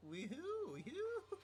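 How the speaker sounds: high swooping whoops; playful siren-like calls; mock cackle whoops. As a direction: sing out silly high-pitched whoops. Imitates the killer's shrill laugh.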